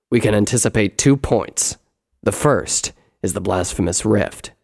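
loud, firm brother's voice; full-voiced story character, assured; reasoning brother. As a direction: argue it forcefully as the elder brother. Brother lays out the points.

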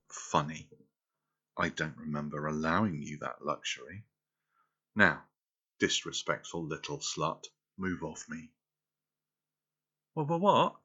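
loud, cold commands; stern dominant. Acted. Dominant lover scolding her disobedient girl, loud and stern. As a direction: snap into a strict mistress, scornful.

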